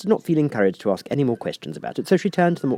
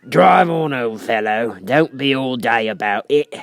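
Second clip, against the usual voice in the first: gruff scolding creature; rough creature voice, scornful; Gryphon voice; 4 semitones higher, 6 dB louder, slower than usual. A narrator's reading. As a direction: chide her like a brusque beast. Gryphon character voice.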